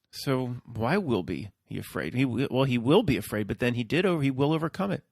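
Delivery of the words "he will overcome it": ordinary talk, nothing marked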